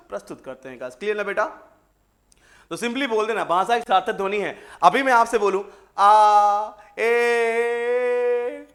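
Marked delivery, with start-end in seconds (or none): 6.0-8.7 loud, drawn-out "aa", then a higher, odd "eh"; meaningless vowel sounds. Shown as a demonstration. Teacher demonstrating a meaningless sound to students, drawn-out vowels. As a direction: make a sound that means nothing. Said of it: "है ना, ये भी ध्वनि है ना".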